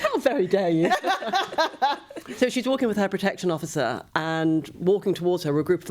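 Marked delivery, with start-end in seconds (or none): 0.0-0.9 mock outrage. Mock offence with laughter. 1.0-2.3 high-pitched burst of laughter. Real, not acted. Laughing after tease.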